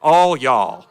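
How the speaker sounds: "all y'all" loud, stress on "all", falling; Southern all y'all. Shown as a demonstration. Pastor asking Cynthia for help, loud Southern "all y'all". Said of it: "help me, Cynthia".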